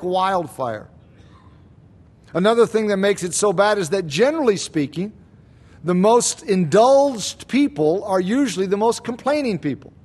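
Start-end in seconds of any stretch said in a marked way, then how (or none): none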